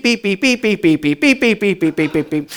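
machine-gun the beeps, letting them fade; imitated bleeped swearing. Mimicking two weeks of overheard swearing, rapid beeping. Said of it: "all I heard there was beep".

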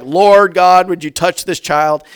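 a hint of a showy prayer; pious prayer voice. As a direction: sound holy on the outside.

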